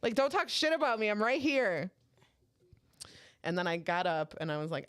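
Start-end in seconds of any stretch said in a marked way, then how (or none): 0.0-1.9 higher, louder indignant protest; retold telling-off. Host confronts comedian who insulted her, retold indignation. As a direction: scold him for backtalk.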